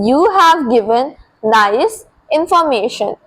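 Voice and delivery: slow, with pauses before and after "nice"; word-group dictation. Shown as a demonstration. Teacher repeating the corrected sentence for learners, slow and segmented. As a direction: set "nice" apart, pausing on both sides.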